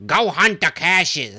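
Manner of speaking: exaggerated, drawn-out New York accent. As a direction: holler it slowly like a New Yorker. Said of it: "I hate the New York accent".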